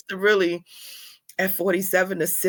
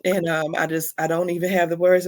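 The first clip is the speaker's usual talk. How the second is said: ordinary delivery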